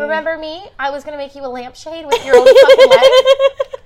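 high, loud, laughing creepy killer; mock creepy killer. Mocking the creepy date to a friend, hammed-up laughing menace. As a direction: ham up the serial-killer date. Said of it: "That's so creepy".

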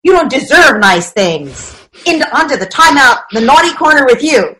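put-on a harsh parent sending a child to timeout; authoritarian parent. Authoritarian parent scolding the child who broke a toy. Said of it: "the authoritarian parent says".

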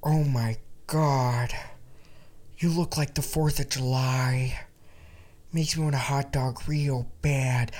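slow breathy soft drawl; lazy, sultry celebrity drawl; Jennifer Coolidge. Retold or imitated. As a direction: purr it out slowly like Jennifer Coolidge. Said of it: "I'm gonna do my Jennifer Coolidge impression".

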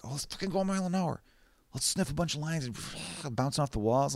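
lightly hammed-up high wired partier; mock cokehead. Mocking cokeheads with a high, fast hyped voice. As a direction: imitate a jittery coke user, high and fast. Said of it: "the idea of, like".

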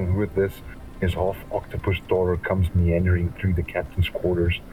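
ordinary delivery

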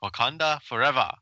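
clumsy mock African Wakanda accent; bad Wakandan accent. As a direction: attempt a Wakandan accent and miss. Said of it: "you're so bad at that accent".

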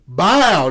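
loud mock gunshot "bow"; gunshot sound. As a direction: punch out a gunshot noise. Acting out a gunshot.

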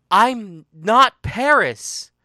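"I'm not Paris" high, loud and slow; anguished loud denial. Acted. She insists she isn't Paris, loud anguished denial. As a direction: cry out "I'm not Paris", every word drawn out. Emphatic repeated denial in a quarrel.

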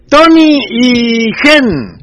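nombres alargados muy despacio, en voz alta; llamando en voz alta. Real, not acted. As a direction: estira los nombres como quien llama a alguien de lejos. Llamando a amigos por micrófono. (llamada alargada y fuerte)

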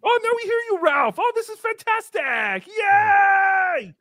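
high-pitched gushing delight, ending in a yell; overjoyed cheer. Acted. Host greets Ralph once finally heard, overjoyed cheer. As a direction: squeal with delight, then cheer loudly.

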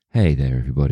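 drowsy bedtime storyteller voice; sleepy voice. Pretend. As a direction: sound half asleep, like a sleepy reading host. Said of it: "Put on your best sleepy voice".

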